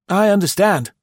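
high, loud, pleasant innkeeper; pleasant host. A narrator's reading. Host excuses the wife's mood, pleasantly. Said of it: "Chalmers said pleasantly".

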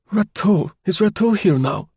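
high, sharp exclaim on the name. A narrator's reading. Chauvelin spotting a name on the list, sharp exclaim. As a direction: pounce on the name Rateau. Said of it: "he exclaimed".

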